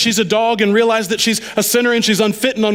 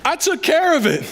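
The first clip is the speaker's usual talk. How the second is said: higher, amused Jesus, a touch playful; lightly voiced Jesus, higher and easy; 13 semitones higher, 5 dB louder than usual. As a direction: play Jesus pleased with her answer. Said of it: "And Jesus looks at her and says".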